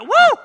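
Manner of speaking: very high, loud whoop; mock cheer. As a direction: whoop like an excited fan. Imitating an admirer's cheer.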